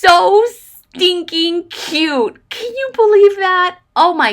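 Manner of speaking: high and loud, stretching out "stinking cute"; overjoyed squeal. Real, not acted. Thanking the viewer who sent the gift, overjoyed and teary. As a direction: gush like the gift just undid you. Unboxing gift, happy tears.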